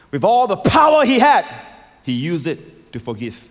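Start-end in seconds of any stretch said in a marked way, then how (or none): 0.1-1.4 sudden high, loud surge; emphatic preaching shout. Real, not acted. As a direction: thunder it out to the congregation. Preacher drives home the point.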